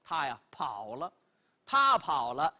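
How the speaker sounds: plain reading voice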